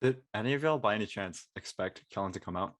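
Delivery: ordinary talk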